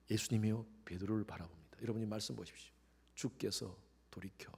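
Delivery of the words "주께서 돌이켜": hushed scripture reading; whisper. Acted. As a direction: breathe the words softly. Scripture read in hush. (속삭이듯 읽는 성경 구절)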